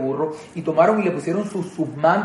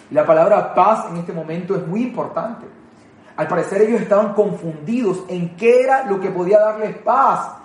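normal talking voice

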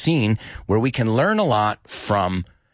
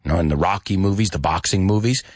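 normal talking voice

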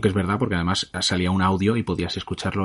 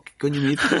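a higher-pitched, mock-baffled comeback; baffled Tom Holland; 7 semitones higher than usual. Actor reacting to an incomprehensible Scottish thug, mock bafflement. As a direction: imitate someone utterly lost, pitched up. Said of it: "Tom Holland se queda, O sea, como".

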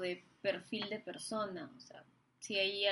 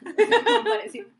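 laughing; 10 semitones higher, 12 dB louder than usual. Real, not acted. Laughing while telling story.

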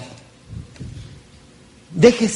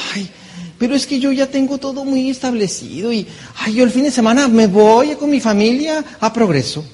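mock complacent whine; 6 semitones higher than usual. Imitating someone resisting change.

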